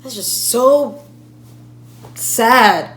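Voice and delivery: loud, impassioned dismay; distressed outburst. Acted. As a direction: burst out, upset on the family's behalf. Outburst over the grieving family.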